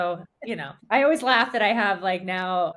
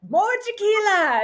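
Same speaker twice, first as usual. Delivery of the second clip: much higher, louder gleeful demand; mock eager cry; 11 semitones higher, 5 dB louder than usual. Recalling lockdown mornings to a friend, mock eager cry. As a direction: beg for the next round like a cartoon. Retelling her lockdown drinking self.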